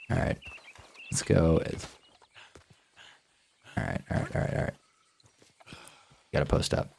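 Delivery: soft unvoiced hunting whisper. Real, not acted. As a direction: breathe the words, stalking quietly. Sneaking up on deer.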